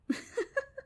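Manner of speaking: sudden high little laugh; girl's mocking laugh. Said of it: "the girl laughed".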